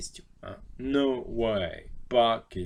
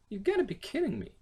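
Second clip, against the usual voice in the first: high disbelief; 8 semitones higher than usual. Shown as a demonstration. Demo, higher.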